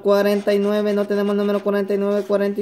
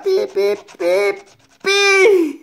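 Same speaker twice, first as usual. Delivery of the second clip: mock beeping; 4 dB louder, much slower than usual. Scratching a lottery ticket for viewers, playful beeping.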